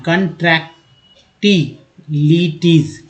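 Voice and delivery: कॉन्ट्रैक्टिलिटीज drawn out slowly, every syllable spelled clear; teacher modelling कॉन्ट्रैक्टिलिटीज slowly for students; slow careful pronunciation. Shown as a demonstration. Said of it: "याद कर लीजिएगा".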